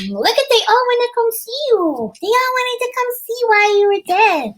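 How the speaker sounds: high, cooing pet-owner voice. Real, not acted. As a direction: tell your dog about its admirers, pitched high. Talking to the dog about viewers.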